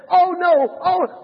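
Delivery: high, loud cry of dismay; screaming out. Acted. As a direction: scream it out as the horrified monk in a joke. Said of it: "Brother Andrew screams out".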